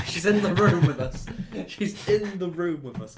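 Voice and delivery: loud, high, laughing outburst; laughing exclamation. Real, not acted. Laughing mock amazement at the joke.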